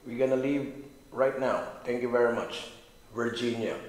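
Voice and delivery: loud, slow goodbye to the room; loud farewell. Real, not acted. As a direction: call it out slowly and clearly. Saying farewell to the spirits.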